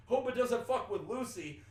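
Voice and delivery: high, loud retold worry; imitated Brooklyn exclamation. Imitating a man filming a strange cat, loud mock concern. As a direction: call it out like a Brooklyn guy. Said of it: "got this thick, like, Brooklyn accent".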